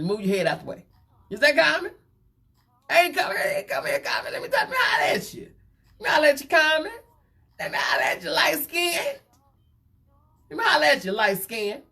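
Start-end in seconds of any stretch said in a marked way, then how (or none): 1.3-1.9 high excited hollering, much higher pitch; mock-shocked caller spotting someone behind her. Gossip host imitating [name] spotting [name] on camera, high and excited. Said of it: "[name] done said". 2.9-11.8 high squealing shout, pitched way up; mock hollering at Common. Host imitating [name] hollering at [name], high mock shout. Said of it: "[name] done said".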